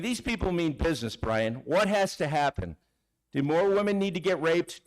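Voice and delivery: ordinary talk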